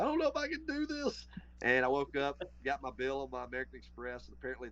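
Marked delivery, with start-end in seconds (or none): 0.0-1.3 high-pitched imitation of tearful wailing; mock crying. Retelling his phone call to Dad, mock crying. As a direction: whine it way up high, like sobbing down the phone. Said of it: "literally crying, calling Dad, saying".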